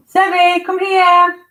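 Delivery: loud, very high call; high-pitched shout to a pet; calling the dog. Real, not acted. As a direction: holler high for the dog to come.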